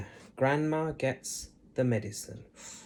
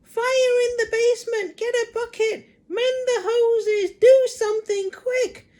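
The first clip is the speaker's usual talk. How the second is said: put-on very high, loud panicky old hag shout; shouting Grandma; 11 dB louder than usual. A narrator's reading. Grandma panics and shouts for the fire brigade. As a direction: yell it like your stomach's burning.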